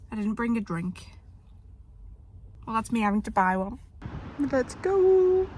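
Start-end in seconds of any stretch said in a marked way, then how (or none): 4.4-5.5 raised, higher hype shout; gym hype call. Real, not acted. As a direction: psych yourself up out loud. Hyping up during the gym workout.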